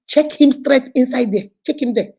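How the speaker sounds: loud, urgent command in retelling; imitated stern order. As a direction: bark out an order as the angry official. Acts out an order to jail.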